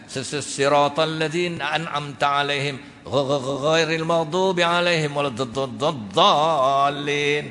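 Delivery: slow stuttering chant of the Fatihah; slow mock stammer, half chanted; imitating stammering imam. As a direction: stammer into ghairil, then chant slowly. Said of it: "Tok Imam gagap tu macam ni".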